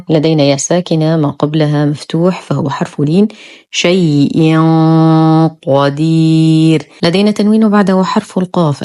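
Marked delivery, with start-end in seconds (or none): 3.7-6.9 slow شيء قدير, tanween hidden in a long thick hum before ق; heavy ikhfa. Shown as a demonstration. Teacher demonstrates tanween before qaf, heavy full ghunnah. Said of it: "إخفاء حقيقي مع غنة كاملة مفخمة".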